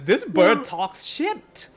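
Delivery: slow, dry deadpan delivery; slow deadpan line. Acted. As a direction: drag it out, bone dry. [name]'s voiced line.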